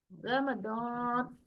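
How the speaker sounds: रमज़ान slowly as "ra-ma-dawn", heavy d and long rounded vowel; correct Arabic pronunciation. Shown as a demonstration. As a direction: recite रमज़ान in three syllables, stretching a thick "dawn". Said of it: "इस तरीके से". (रमज़ान को "र-म-दॉऽन" मोटा, खींचकर पढ़ें)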